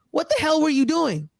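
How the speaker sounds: high-pitched mock scolding, not at full volume; imitated sideline yelling. Coach yelling at his quarterback on the sideline, imitated scolding. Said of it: "he was yelling at him on the sideline".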